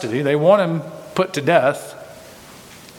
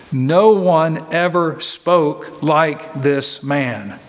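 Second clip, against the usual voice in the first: slow awed quote; much slower than usual. Acted. Pastor voices the officers' awed answer.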